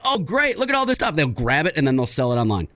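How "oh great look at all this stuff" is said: high, gushing mock excitement; excited. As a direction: hype up the find like an online video. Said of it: "and then be like".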